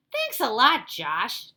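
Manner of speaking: loud, high, biting sarcasm; sarcastic thanks. Acted. Dink to Josh after ruined letter, loud sarcasm. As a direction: throw the thanks back at him.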